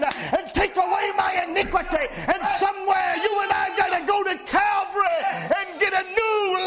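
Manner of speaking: high, ringing preacher's cry building on every line; fervent sermon climax, half-shouted; full-force preaching. Real, not acted.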